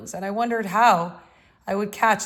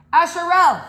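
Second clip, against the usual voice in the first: sharp, much louder, higher call of the name; strange loud call; 9 semitones higher, 11 dB louder than usual. A narrator's reading. The Masfia shouts at the dreaming boy, loud and high. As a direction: snap him out of his daydream. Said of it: "saying in a strange, loud voice".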